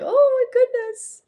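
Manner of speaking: high, sung-out squeal of dismay; mock high-pitched panic. Acted pre-video dread, being like.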